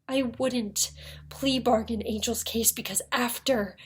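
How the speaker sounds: ordinary delivery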